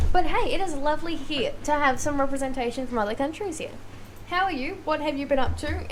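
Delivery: ordinary talk, nothing marked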